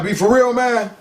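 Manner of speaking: high, loud rising challenge; getting mad. Retold or imitated. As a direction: press him, voice up high and loud. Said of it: "Now I'm getting mad".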